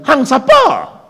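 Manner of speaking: sharp high mocking "who are you"; mocking a rude stranger's retort, loud and high. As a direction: fling back a rude challenge, loud and high. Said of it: "Dia tanya balik".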